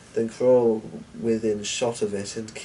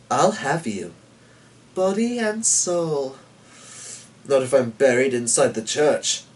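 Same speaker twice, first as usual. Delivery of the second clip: lightly voiced devil, sly and sure of himself; a devil claiming his due, gently taunting; a hint of the devil; 5 semitones higher, 3 dB louder than usual. A narrator's reading.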